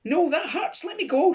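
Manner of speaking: very high, loud pained shriek; faked shriek. Old lady pushing the man away, fake pained shriek. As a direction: shriek to be let go. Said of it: "shrieked Lady Grant".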